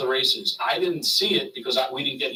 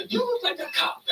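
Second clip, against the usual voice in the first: much higher, put-on voice of a wary promoter; high suspicious Paul voice. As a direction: squeak it out like a paranoid boss.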